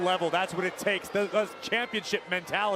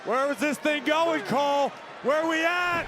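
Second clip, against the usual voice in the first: excited commentator; 7 semitones higher than usual. Real, not acted. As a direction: hype up the crowd at home. Co-commentator hyped by action.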